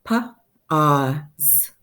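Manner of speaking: pa-ah-z, three sounds pulled apart; segmented word. Shown as a demonstration. Teacher breaking "Pa-ah-z" into sounds for students. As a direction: sound out "Pa-ah-z" piece by piece. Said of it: "makes that ah sound".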